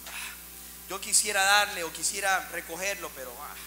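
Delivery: a hint of a shrugging priest mumbling excuses; dismissive priest. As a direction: priest walking past the wounded man, indifferent mumble. Priest passes by the wounded man.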